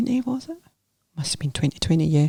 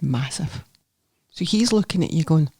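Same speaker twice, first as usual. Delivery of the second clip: usual speaking voice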